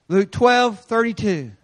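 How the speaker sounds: ordinary talk, nothing marked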